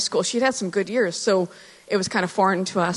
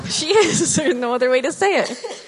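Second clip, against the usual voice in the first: laughing; 8 semitones higher than usual. Real, not acted. Laughing about her feisty daughter.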